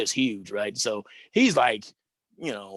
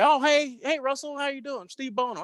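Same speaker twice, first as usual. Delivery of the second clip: much higher, eager, chummy hello; friendly greeting; 10 semitones higher than usual. Retold or imitated. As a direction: play the friendly teammate breaking the ice. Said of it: "And Steve Bono said".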